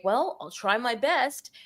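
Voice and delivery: a slow, unsure juror voice, lightly done; hesitant juror. Retold or imitated. Juror answering the prosecutor, slow and unsure. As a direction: drag it out like a juror hedging. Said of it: "a juror would say".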